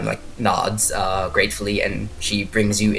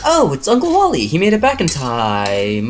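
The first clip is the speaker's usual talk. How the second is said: high-pitched excited exclamation, drawn out at the end; lightly acted excitement; 4 semitones higher, 3 dB louder than usual. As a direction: play an aunt spotting a surprise arrival. Voicing Melba spotting her uncle.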